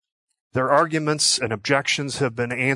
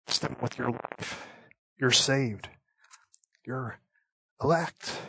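much softer, near-hushed comfort; tender reassurance; 8 dB quieter than usual. Real, not acted. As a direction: gently assure a doubting listener. Tender assurance to doubting believer.

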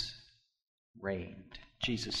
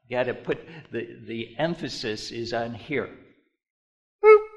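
normal talking voice

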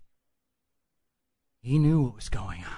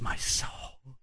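hushed whisper. Acted. Jesus confiding his horror, whispered. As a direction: breathe the words as if afraid. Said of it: "he says".

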